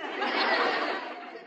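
hoarse laugh. Acted. Laughs at Jughead's joke.

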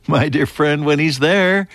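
ordinary talk, nothing marked

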